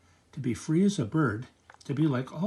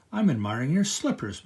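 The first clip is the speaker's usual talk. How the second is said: ordinary delivery, nothing marked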